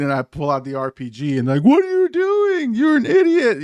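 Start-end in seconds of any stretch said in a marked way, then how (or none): 1.6-3.6 very high, drawn-out mock screech; imitated yelling. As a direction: screech it slowly like an outraged teammate. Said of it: "everyone's yelling".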